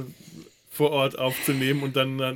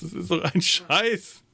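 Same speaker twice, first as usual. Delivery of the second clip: annoyed; 6 semitones higher than usual. Real, not acted. Annoyed at recording levels.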